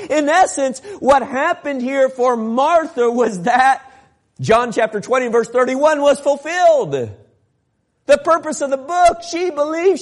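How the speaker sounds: high, loud preacher's delivery; forceful preaching. Real, not acted. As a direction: drive it home to the congregation.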